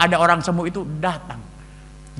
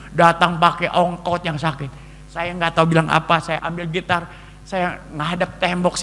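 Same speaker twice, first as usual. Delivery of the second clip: normal talking voice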